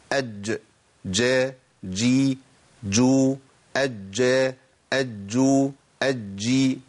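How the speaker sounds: ordinary talk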